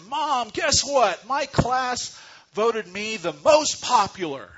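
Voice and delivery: put-on high, loud, beaming child's voice; excited little girl. Daughter telling mom her class news, high and excited. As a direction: squeal it out like a proud schoolgirl. Girl's excited quote in story.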